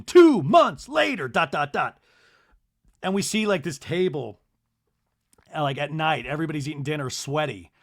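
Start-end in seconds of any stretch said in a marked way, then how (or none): 0.0-2.0 loud, high, slow mock announcer; on-screen caption read-out. Reading the chyron on screen, loud dramatic announcement. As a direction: boom out each word dramatically. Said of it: "we see a chyron pop on screen".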